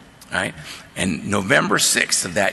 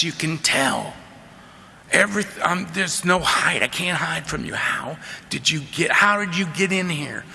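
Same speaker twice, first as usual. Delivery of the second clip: retold tearful plea; 5 semitones higher, slower than usual. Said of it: "into tears".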